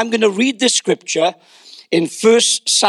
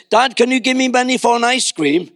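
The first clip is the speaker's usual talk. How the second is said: put-on casual, higher-pitched kid asking Dad; little boy's breezy request; confident young son; 4 semitones higher than usual. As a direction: ask easily, like a child sure of a yes. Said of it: "why can't you be like that with me".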